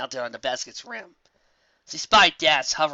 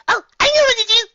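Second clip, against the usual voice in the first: very high, loud, bubbly; excited Pinkie; 11 dB louder than usual. Acted. Pinkie bursts out with an idea, very high and loud. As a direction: burst out eagerly.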